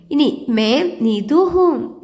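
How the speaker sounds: slow, clear model sentence for pupils. Shown as a demonstration. Teacher models a self-introduction, slowly and clearly. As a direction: say it slowly so the class can repeat. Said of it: "നിങ്ങൾ നിങ്ങളുടെ പേര് പറഞ്ഞോളൂ".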